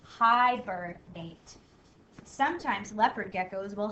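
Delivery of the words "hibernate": HIBERNATE drawn out slow, each of three syllables clear. Shown as a demonstration. Teacher to young spellers, slowly sounding out Hibernate. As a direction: stretch out Hibernate so all three syllables ring. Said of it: "You hear all three syllables".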